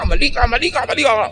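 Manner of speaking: high, insistent mock accusation; angry accusation. Angry person accusing the calm partner, mock furious. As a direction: jab back at them, angry and shrill-free. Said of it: "Galit na galit siya".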